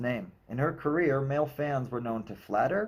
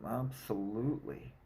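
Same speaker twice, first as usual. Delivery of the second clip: mumbled; 2 semitones lower, 9 dB quieter than usual. A narrator's reading. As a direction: mumble it under your breath. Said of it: "before mumbling".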